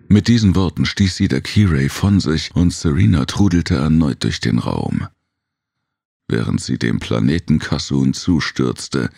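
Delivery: plain reading voice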